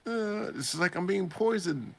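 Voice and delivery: high, whiny complaining about a stomach ache; mock sick groaning. The man moaning about poisoned water, high whine. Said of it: "the man's like".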